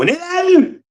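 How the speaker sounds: mocking her voice leaping up; mocking sing-song. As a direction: imitate her voice jumping up at the end. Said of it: "She be talking normal".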